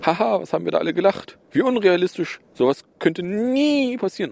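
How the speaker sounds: high, mocking sarcasm; sarcastic. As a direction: laugh it off with heavy irony. Spöttischer Rückblick auf Warnungen. (übertrieben sarkastisch)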